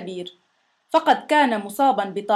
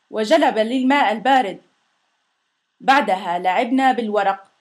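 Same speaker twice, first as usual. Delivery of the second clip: ordinary delivery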